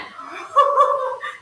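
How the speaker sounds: bright real giggle; short delighted laugh. Real, not acted. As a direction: laugh at the silly splats. Laughs after loud splats.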